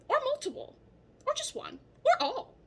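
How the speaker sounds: high playful singsong list. Real, not acted. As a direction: tease each option lightly. Playful list of flare options.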